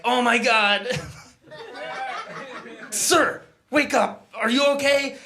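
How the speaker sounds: mock alarm over someone hit by a car; panicked passerby.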